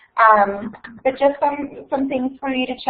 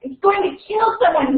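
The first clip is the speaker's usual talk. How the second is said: shouting; 7 semitones higher than usual. A narrator's reading. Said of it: "he shouted".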